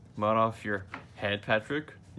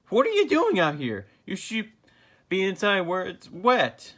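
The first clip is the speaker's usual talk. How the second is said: surprised high voice; 8 semitones higher, 5 dB louder than usual. Acted. Patrick surprised to find them.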